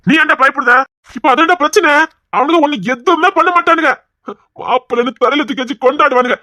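high and loud, arguing hard; exasperated outburst. Acted. Man arguing with friend about in-laws, loud and exasperated. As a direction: raise your voice to make your case.

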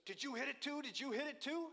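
high, loud mock shout through glass; urgent, high-pitched questions flung at a stranger; excited stranger. Stranger to driver through the cracked window, high and excited.

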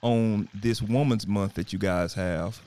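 normal talking voice

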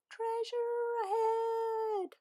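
high, slow, far-off call; playing pirates with the dog. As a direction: sing out like a lookout. Pretend pirate play.

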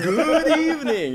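high, slow, loud laughing greeting; hammed-up show intro voice; spooky host greeting. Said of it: "You do that when you do your episodes".

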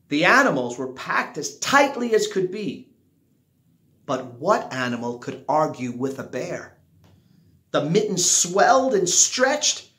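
ordinary delivery

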